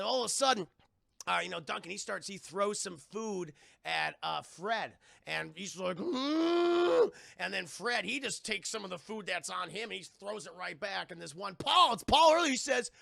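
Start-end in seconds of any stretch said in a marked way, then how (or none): 6.0-7.1 high, loud, drawn-out hum; imitated straining noise. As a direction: hold a loud wordless noise in character. Imitates reaction to thrown food.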